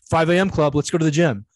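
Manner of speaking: loud, eager wake-up call at the door; pumped trainer's early call; mock wake-up call. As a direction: rouse a sleepy friend cheerfully.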